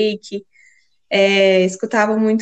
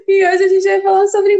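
laughing; 10 semitones higher, 5 dB louder than usual. Real, not acted. Restarts line after laughing.

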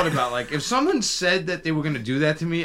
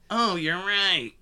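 imitating Krillin; 5 semitones higher, slower than usual. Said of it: "I hate his voice sometimes".

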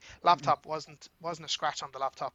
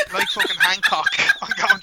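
laughing exclamation; 10 dB louder than usual. Real, not acted. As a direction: burst out laughing mid-sentence, high. Laughing at flying story.

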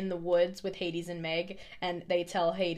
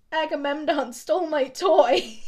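put-on higher, louder, slow whiny kid complaint; crying little boy; 7 semitones higher, 7 dB louder, slower than usual. Little boy in the back seat complaining to grandmother, high whiny. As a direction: whine it like a tattling small boy. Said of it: "the little boy goes".

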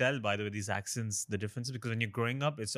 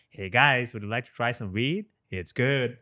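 put-on American accent; 6 dB louder, slower than usual. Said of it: "one guy came up to me on the street".

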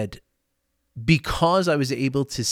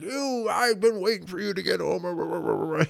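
high, drawn-out sulky nag; imitating a whiny greeting; mock whiny complaint; 6 semitones higher than usual. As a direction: play it as a petty spouse griping. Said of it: "Not, not laced in like".

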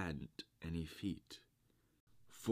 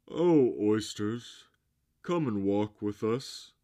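loud, higher, coaxing call; beseeching walrus; 5 semitones higher, 11 dB louder than usual. A narrator's reading. Walrus invites the oysters for a walk, loud beseeching. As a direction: call out grandly, begging them along. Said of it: "the walrus did beseech".

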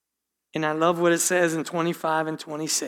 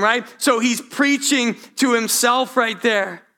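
normal talking voice

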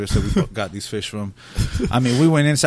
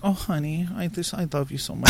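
lightly voiced doting wife, a bit higher and softer; mock sweet-nothing to a husband; imitated wife; 5 semitones higher, 6 dB quieter than usual. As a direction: imitate a wife's loving coo. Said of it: "She'll be like".

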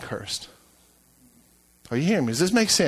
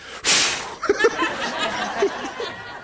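long hissing exhale imitating his wife; exaggerated airy huff; mimicked huff; 3 dB quieter than usual. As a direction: blow out air in mock exasperation. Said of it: "And then she's like".

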